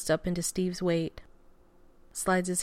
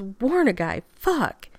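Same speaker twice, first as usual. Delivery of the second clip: startled protest; 3 semitones higher, 5 dB louder, slower than usual. A narrator's reading. Groom caught off guard by cold metal, startled protest. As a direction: yelp out an indignant complaint.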